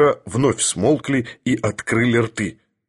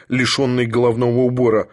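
usual speaking voice

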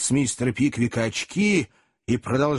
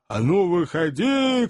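raised, drawn-out challenge; shouted challenge; 5 semitones higher, slower than usual. A narrator's reading. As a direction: call them out to fight. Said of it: "кричал кэбмен". (задиристый протяжный выкрик)

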